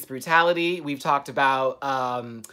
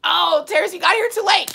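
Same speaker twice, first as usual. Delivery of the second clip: much higher and louder greeting; excited call-out; 8 dB louder than usual. Real, not acted. Greeting a late viewer, higher and louder. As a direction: call out to the latecomer.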